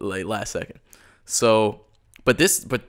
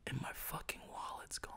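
whispered shock; 24 dB quieter than usual. Real, not acted. Telling his friend his wallet vanished, whispered in shock. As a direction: whisper it, stunned.

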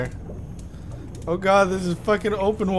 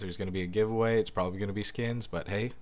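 outro read; 6 semitones lower, 10 dB quieter than usual. Acted.

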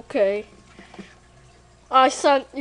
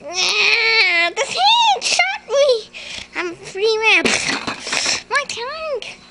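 excited high yelling; 11 semitones higher than usual. Acted. Hamster charges into battle.

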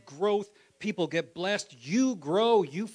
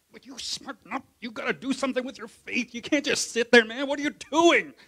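loud, high mock rebuke as James; imitating James; 11 semitones higher, 14 dB louder than usual. Preacher voicing James shaking a lazy believer, loud mock rebuke. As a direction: shake them awake like rough James. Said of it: "James grabs you like this and goes".

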